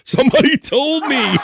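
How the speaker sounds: high cackling laughter through the words; bursting out laughing. Real, not acted. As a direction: lose it laughing at the joke. Cracks up at crude comment.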